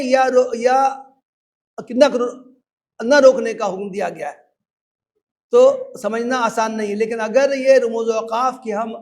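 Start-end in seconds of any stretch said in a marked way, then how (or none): none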